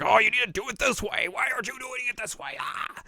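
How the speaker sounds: high-pitched exasperated rant; hammed-up tantrum ending in a cry; mock anger. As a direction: rant in a squeaky fake fury. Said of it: "mad about it".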